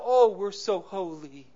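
slow, drawn-out mock piety; mock self-righteous. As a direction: play at being oh so holy. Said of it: "we'd like to believe somehow".

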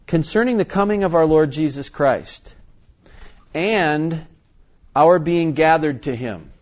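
loud, deliberate reading with a weighted AND; scripture reading. Acted. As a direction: proclaim the verse, pausing round the key word.

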